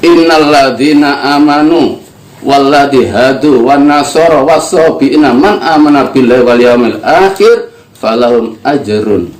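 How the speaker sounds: ordinary talk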